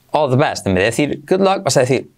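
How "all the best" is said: quick run-together all the best; extreme contraction. Shown as a demonstration. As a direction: blend it into one breath. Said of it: "luego la contracción extrema". (contracción extrema, rápida y ligada)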